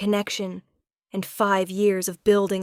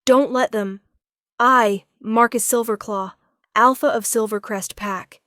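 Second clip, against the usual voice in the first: strained loud; 3 semitones higher, 7 dB louder than usual. A narrator's reading. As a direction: force the words out in anguish. Marcus in anguish.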